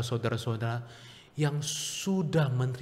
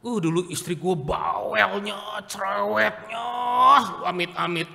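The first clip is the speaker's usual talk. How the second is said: mock husband complaint; 6 semitones higher, 4 dB louder than usual. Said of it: "misal suami lihat si tante".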